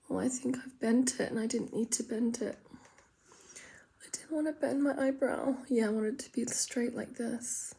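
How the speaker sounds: very soft, half-whispered muttering; hushed, to yourself; absorbed self-talk. Real, not acted. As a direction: think aloud under your breath while concentrating. Muttering to herself while drawing brows.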